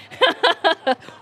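high burst of giggles; laughing. Real, not acted. Comic cracking up at her own story, laughing.

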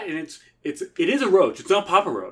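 ordinary delivery

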